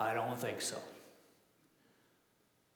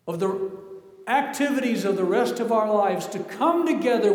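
louder, driving preacher's cadence; building sermon climax, louder; preaching at full force; 4 semitones higher, 7 dB louder than usual. Acted. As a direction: preach it to the room with fire.

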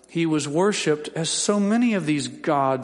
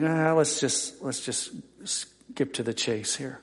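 much softer, sheepish aside; embarrassed Peter's thought; 3 semitones lower, 6 dB quieter than usual. Retold or imitated. Peter skipping the awkward praise, a soft sheepish aside. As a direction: hurry past it under your breath. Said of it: "you think".

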